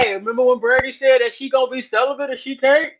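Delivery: mocking gossip voice. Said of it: "he gonna be on here talking about".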